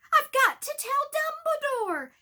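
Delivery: high, loud desperate yell. Acted. Harry yelling at Snape. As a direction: yell it at him. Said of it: "Harry yelled".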